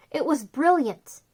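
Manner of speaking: ordinary delivery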